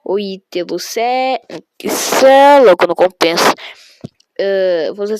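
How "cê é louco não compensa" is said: shouted exclamation. Pretend. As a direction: erupt into a yell. Shouts a reply to chat.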